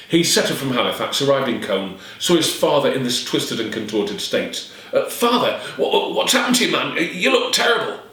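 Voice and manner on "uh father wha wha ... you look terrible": shocked son. Acted.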